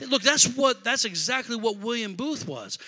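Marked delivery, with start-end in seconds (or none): none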